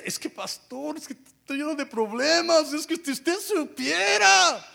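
high, whiny mock lament; hammed-up whining believer; complaining churchgoer. A believer whining to the pastor about problems, high and whiny. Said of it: "¿Y sabía usted?".

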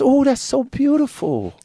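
high, slow, airy admiring voice; Michael impression. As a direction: imitate Michael cooing over a gold chain. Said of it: "He says".

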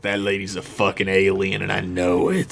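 put-on vocal fry old man sure of his hunch; suspicious Mark Twain. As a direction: squint and mutter your suspicion. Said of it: "he does the fry".

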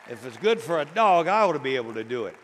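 loud, quick emphatic punchline; preaching at full force. Acted. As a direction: hammer the point home fast.

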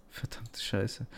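whispered curse under the breath; cursing under breath. Real, not acted. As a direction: hiss it to yourself in frustration. Stuck searching for a word. (leise vor sich hin fluchen)

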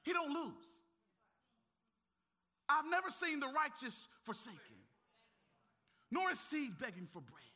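loud, high preacher's call; rising pulpit declaration; full-force preaching. Acted. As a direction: preach it out to the congregation.

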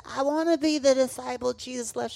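put-on loud, high child's insistence; pleading kid. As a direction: beg like a stubborn nine-year-old. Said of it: "I said".

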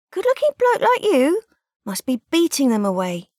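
very high, loud cheeky flattery; butter him up with mock flattery; flirty banter. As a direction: stallholder teasing the blushing lad.

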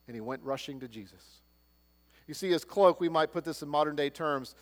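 ordinary talk, nothing marked

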